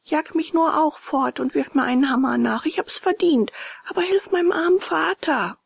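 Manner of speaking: put-on high, pleading boy's voice; earnest high boyish plea; remorseful puppet boy. A narrator's reading. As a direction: beg for father's sake, pitched high.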